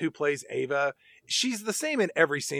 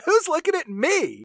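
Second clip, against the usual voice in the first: much higher and louder, playing to the cheap seats; mock a child grabbing every eye; showy stage kid; 12 semitones higher, 5 dB louder than usual. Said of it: "when she comes out, it's like".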